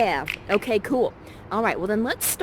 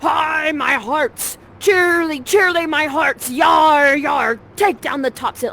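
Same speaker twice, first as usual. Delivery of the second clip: high, loud boatswain's cry over a storm; hearty sailor yelling into the storm; shouting sailor; 6 semitones higher, 9 dB louder than usual. Acted. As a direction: bellow orders to the crew over the waves. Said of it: "We go".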